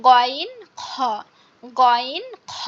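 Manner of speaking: গইন খ with a scraping, cough-like খ; cough-like throat sounds. Shown as a demonstration. Teacher models the letters for students, throaty গইন খ. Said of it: "এভাবে আমাদের এটা উচ্চারণ করতে হবে".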